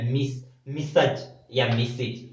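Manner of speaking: मिसज and मिसिज said slowly one after the other; two pronunciations shown. Shown as a demonstration. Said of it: "दो तरह से इसका pronunciation करते हैं". (मिसज और मिसिज धीरे-धीरे अलग करके बोलना)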